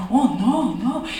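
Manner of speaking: high-pitched feeble protest; flustered scammer. Retold or imitated. Scammer asked to hand back the coin, flustered denial. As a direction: imitate a caught man playing innocent. Caught scammer feigns innocence.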